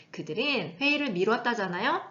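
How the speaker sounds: ordinary talk, nothing marked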